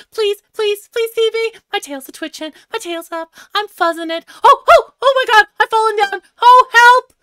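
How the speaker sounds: excited high squirrel chatter building to panic; squirrel character. Acted. Player voices the squirrel hoping for a photograph, excited. Plays the squirrel spotting people, falling.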